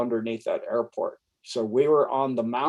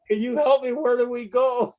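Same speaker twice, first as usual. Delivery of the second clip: mock plea; 12 semitones higher than usual. Pleading with the dog.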